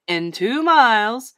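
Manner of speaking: loud, slow satnav lady; measured, commanding machine lady; bossy lady's voice. A narrator's reading. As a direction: give directions like a bossy sat-nav. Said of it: "in a bossy lady's voice".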